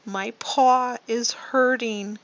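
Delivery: the hurt lion answers the mouse, slightly pained. Acted. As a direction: whine a little about the sore paw. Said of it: "The lion answered".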